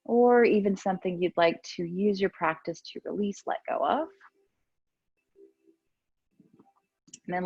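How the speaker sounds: unhurried, soothing cues with long pauses; guided meditation. Real, not acted. Yoga teacher settles class into breathing and stillness, calm guided meditation.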